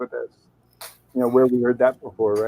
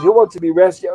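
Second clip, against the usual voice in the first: higher and louder outraged question; mock indignant shout; 6 semitones higher, 6 dB louder than usual. Skipper scoffing at the castaways, mock indignant. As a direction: toss the question back, exasperated.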